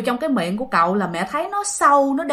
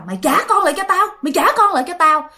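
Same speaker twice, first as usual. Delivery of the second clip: furious demand; 7 semitones higher, faster than usual. Retold or imitated. Mother confronting ghost Bảy, fast high furious demand. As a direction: demand the kids back, knife raised. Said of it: "mẹ mới kêu rằng á là".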